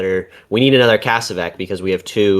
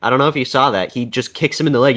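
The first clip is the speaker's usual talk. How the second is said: ordinary talk, nothing marked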